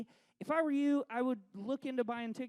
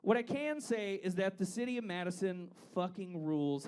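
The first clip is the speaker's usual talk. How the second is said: normal talking voice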